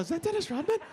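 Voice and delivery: high-pitched incredulous question; starstruck astonishment. Acted. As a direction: gape in amazement, voice way up high. Both men react to celebrity's entrance.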